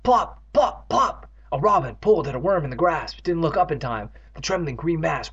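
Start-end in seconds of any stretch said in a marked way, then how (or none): 0.0-1.3 high, punchy plops; squishing bounce noises. A narrator's reading. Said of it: "making disgusting squishing noises".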